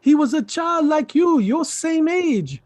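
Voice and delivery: high-pitched, excited urging; eager persuasion. Acted. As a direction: win them over with rising enthusiasm. Uncle eagerly sells the visitor.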